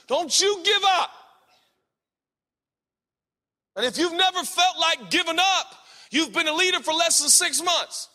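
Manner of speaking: loud, high, pounding preacher shout; full-force preaching. Acted. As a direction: drive it home to the congregation.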